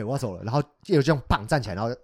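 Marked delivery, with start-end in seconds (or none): 0.0-0.4 cold quiet. Retold or imitated. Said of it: "对".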